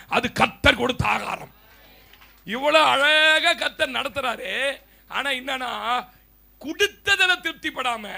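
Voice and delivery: loud, rising sermon climax, slow in places; preaching. Acted. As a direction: preach it at full force. Sermon rising to full force. (உரத்த பிரசங்க குரல்)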